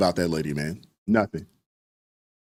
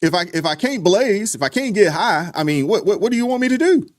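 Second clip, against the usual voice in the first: much higher, whiny stoner impression; mock stoner voice; 10 semitones higher than usual. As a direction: play the lazy guy begging to get high. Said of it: "They're like".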